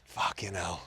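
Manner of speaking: a quiet half-laughing curse to himself; muttered to himself. Retold or imitated. Cyclist mutters to himself about his inner voice, quiet curse. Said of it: "And I went".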